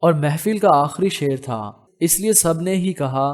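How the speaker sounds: ordinary delivery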